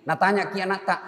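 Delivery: usual speaking voice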